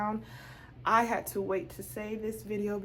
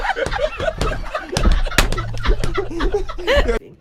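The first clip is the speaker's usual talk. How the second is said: loud high laugh; 13 dB louder than usual. Retold or imitated. Clerk bursting out laughing at her.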